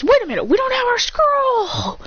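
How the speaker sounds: high-pitched swooping Naruto protest; alarmed protest. Retold or imitated. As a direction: squeal it out in mock alarm.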